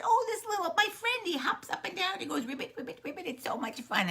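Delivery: high, gushing little-one voice; excited little snake. Acted. Little Snake tells mother about the friend, excited and high. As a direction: bubble over in a high voice.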